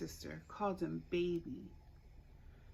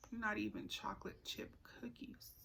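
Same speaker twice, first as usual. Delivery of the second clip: whispered aside; 8 dB quieter than usual. A narrator's reading. Aside to child.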